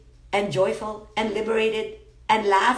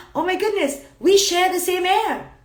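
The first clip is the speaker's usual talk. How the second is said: high-pitched gasp of discovery, landing louder; astonished realization; 8 semitones higher, 4 dB louder than usual. Acted. Meditator realizing we share the same air, astonished. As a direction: act out a sudden revelation in a raised pitch.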